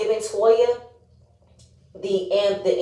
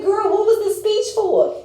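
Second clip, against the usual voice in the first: mock incredulous friend; 12 semitones higher than usual. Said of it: "he's like".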